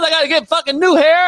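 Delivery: usual speaking voice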